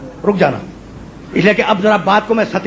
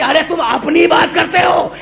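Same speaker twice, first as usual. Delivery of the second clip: high, ringing declamation, stretched out; majestic wrath; 7 semitones higher than usual. Retold or imitated. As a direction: build a lofty rebuke to a climax. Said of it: "رحمت نے جلال کے عالم میں کہا". (جلال بھرا بلند لہجہ)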